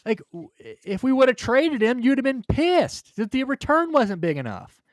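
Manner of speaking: real frustration, loud and high; exasperated rant. Real, not acted. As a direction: vent loudly at the fans.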